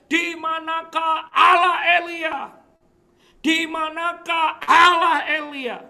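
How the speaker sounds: loud, high, drawn-out shout; full-force cry. Acted. Elisa calling out for Elijah's God, loud desperate shout. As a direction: cry out as hard as you can, stretching the words. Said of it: "teriak sekencang-kencangnya dia berteriak".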